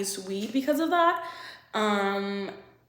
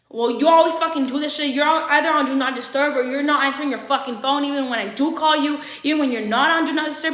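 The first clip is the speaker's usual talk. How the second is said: put-on loud, higher, irate boyfriend tirade; retold heated complaint; accusing rant; 5 semitones higher, 6 dB louder than usual. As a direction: imitate him angrily laying into her. Acts out his accusing rant.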